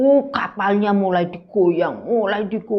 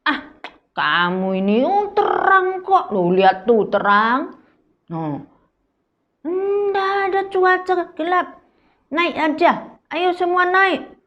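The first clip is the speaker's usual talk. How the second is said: high, loud, brushing off the warning; dismissive officer; 10 semitones higher, 4 dB louder than usual. Acted. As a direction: scoff that the sky is clear. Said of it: "Pak Juliusnya bilang". (perwira meremehkan, suara tinggi dan keras)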